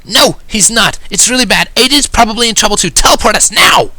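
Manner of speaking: loud, high-pitched urgent outburst; urgent Rainbow. A narrator's reading. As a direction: spill it out in a high, loud panic. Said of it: "Rainbow rapidly spilled out".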